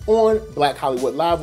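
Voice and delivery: normal talking voice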